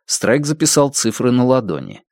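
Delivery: usual speaking voice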